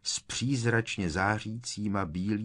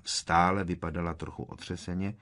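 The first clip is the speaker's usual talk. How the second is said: ordinary delivery, nothing marked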